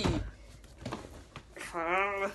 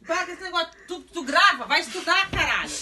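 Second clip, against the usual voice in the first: loud, higher, scolding rant; angry scolding; 7 semitones higher, 7 dB louder than usual. Real, not acted. Mom scolds son for filming instead of studying. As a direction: tell him off hard.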